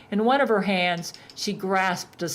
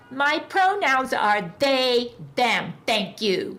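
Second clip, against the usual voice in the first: high, slow bleating goat voice; 5 semitones higher, slower than usual. A narrator's reading. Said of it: "Baphomet bleated".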